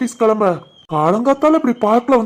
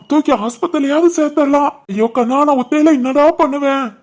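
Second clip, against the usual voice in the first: higher, helpless fake lament; pretend panic; 4 semitones higher than usual. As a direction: lament being left alone with him, overacted. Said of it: "எழுப்புற மாதிரி பதட்டமா இருடா". (தவிப்பது போன்ற polyp புலம்பல்)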